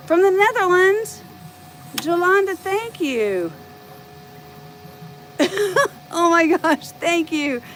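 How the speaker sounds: high, loud, delighted shout-out; excited thanks. Real, not acted. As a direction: gush with real excitement at the viewers. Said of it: "I really am excited".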